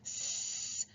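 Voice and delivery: a long unvoiced sss; hissed S sound. Shown as a demonstration. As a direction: hiss through the teeth. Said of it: "My tongue is kind of hitting".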